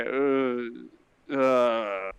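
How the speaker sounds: put-on drunken reluctance. Intoxicated paladin lifting his head at the commotion, drunken groan. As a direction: groan it out, too drunk to bother. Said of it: "An incredibly intoxicated paladin".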